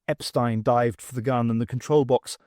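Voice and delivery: ordinary delivery, nothing marked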